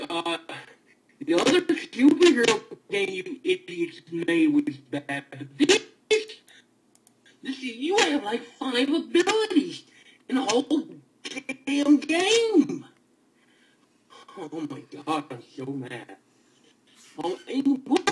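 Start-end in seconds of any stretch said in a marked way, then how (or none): 5.3-12.9 exasperated high-pitched griping; indignant rant. Real, not acted. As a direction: let the outrage build. Ranting at game makers.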